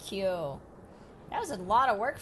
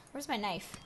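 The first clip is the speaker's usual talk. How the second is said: usual speaking voice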